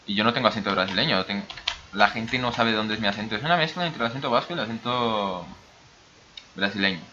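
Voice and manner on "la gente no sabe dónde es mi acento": ordinary talk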